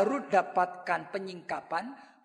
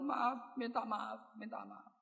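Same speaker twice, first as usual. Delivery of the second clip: softer, fading, weary apology; quiet apology; 3 semitones lower, 14 dB quieter, slower than usual. Retold or imitated. Grieving father apologizing to annoyed stranger, softly fading. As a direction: murmur sorry as if drained.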